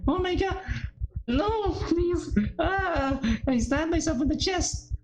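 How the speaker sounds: high, breathless dread; horrified. Acted. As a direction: cry it out as the knife goes in. Character stabs herself, panic.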